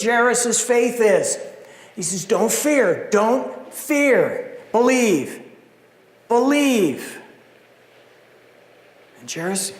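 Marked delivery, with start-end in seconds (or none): 2.2-7.2 earnest urging. Acted. Jesus urging repeated.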